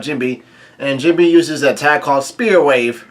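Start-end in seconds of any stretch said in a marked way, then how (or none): none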